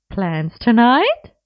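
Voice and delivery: barely hiding her glee; gleeful teasing. Acted. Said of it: "not even bothering to hide her glee".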